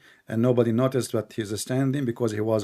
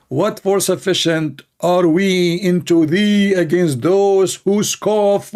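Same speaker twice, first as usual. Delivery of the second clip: mocking reading; 7 semitones higher, 6 dB louder, slower than usual.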